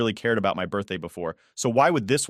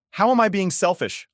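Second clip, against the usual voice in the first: high, louder angry snap; snapping back; 11 semitones higher, 5 dB louder than usual. Acted. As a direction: hurl the question back at them. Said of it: "I snap back".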